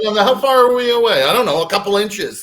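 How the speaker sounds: high, loud comic travelers; mock navigators. Mocking map-reading space travelers.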